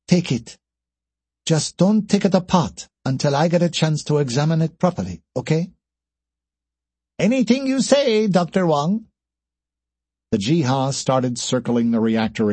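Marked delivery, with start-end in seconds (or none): 0.1-5.7 dismissive. A narrator's reading. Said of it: "waved a hand dismissively". 7.2-9.1 very high character voice; squeaky-high, deferential answer; alien engineer's reply. Acted.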